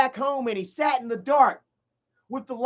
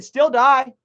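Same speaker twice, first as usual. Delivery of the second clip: loud, flat dismissal; correcting the quote with the killer's cold, loud line; cold still die; 4 semitones higher, 7 dB louder than usual. Retold or imitated. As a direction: say it like the verdict is already in. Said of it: "is how he said".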